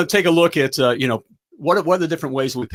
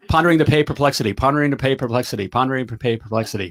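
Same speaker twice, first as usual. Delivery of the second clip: tongue twister said fast; 2 semitones lower, faster than usual. Shown as a demonstration. Host takes the viewers' dare to say it fast. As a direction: rattle off Pondering the pay perplexity at a fast clip. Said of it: "Say it three times fast".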